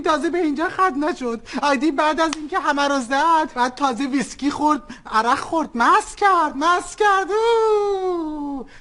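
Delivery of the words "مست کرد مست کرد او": mock crying. Mother mimics crying son.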